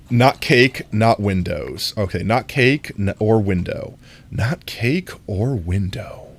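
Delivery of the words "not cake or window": mock sultry. Shown as a demonstration. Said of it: "How's that?".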